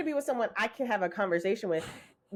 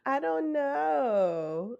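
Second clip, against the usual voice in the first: slow, higher giggly I don't know; giggling; 5 semitones higher, much slower than usual. Retold or imitated. Mocking [name] giggling at a flirty man, higher and slow. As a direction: giggle it coyly like she's flattered. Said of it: "for her to be giggling".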